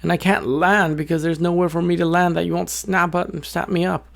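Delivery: high, stammering bird voice; little bird. A narrator's reading. Little bird explaining why it can't land, high and flustered. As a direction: plead your case in a high little voice. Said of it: "And the little bird says".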